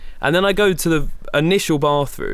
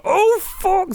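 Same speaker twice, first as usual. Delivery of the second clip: much higher, strangled oh; dismayed squeak. Retold or imitated. Finding Evan's room locked, squeaky dismay. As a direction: voice cracks up high in dismay.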